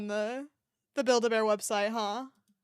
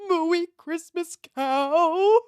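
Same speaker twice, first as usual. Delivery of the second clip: squealing delight; 7 semitones higher, 10 dB louder, much slower than usual. Real, not acted. Squealing over cute cow toy.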